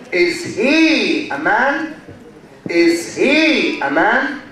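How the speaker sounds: slow, arching "he", then "man" sweeping up; high intonation pattern. Shown as a demonstration. Teacher demonstrating yes-no question, slow rising intonation. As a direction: draw the question out, going from down up on "man". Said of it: "So we go from down up. This is called high intonation pattern".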